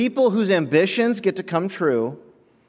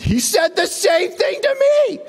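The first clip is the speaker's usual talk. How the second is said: high, shouted outrage from a coworker; hammed-up coworker shouting; shouted complaint. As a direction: yell it in disbelief. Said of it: "he goes".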